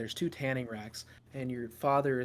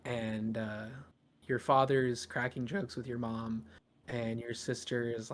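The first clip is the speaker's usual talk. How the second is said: ordinary talk, nothing marked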